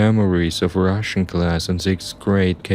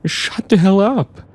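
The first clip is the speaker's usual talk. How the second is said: high-pitched desperate yell; 13 semitones higher, 4 dB louder than usual. A narrator's reading. Narrator at the taunting driver, yelling. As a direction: snap back in a high yell. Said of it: "I yelled at him".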